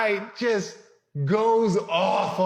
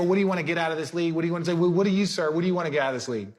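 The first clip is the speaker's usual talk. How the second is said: rattles the question off fast, over and over; rushed interviewer; much faster than usual. Retold or imitated. Pre-draft interviewer pressing the players, rapid-fire questioning. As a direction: fire "What do you wanna get out of this league?" at speed. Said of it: "So then they go".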